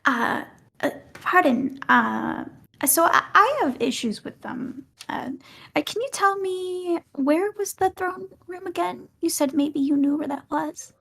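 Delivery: character voice. Acted. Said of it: "Voice on".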